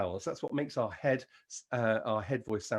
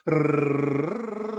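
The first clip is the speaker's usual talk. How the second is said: a rolled R sung on a raised note; high rolled r; 5 dB louder than usual. Shown as a demonstration. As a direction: roll the r on a higher sung note. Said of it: "higher note for the rrr".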